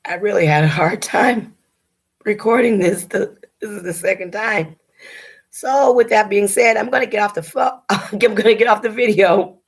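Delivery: fighting to keep from crying while talking; choked-up, barely holding it together; holding back tears. Real, not acted. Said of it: "but I'm trying not to cry".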